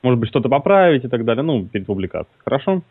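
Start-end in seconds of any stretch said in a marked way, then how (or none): none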